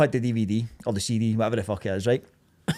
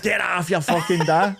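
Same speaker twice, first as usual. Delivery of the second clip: higher laughing jeer, slowed; 6 semitones higher, slower than usual. Retold or imitated. Boys in the back teasing Skelly about his dad, laughing. Boys mocking friend in car.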